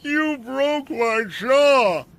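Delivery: high, slow, pained shout; agonized shout. A narrator's reading. Bowman on the ground crying to Rick, agonized shout. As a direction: wail it out in agony. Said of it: "slurred and agonized".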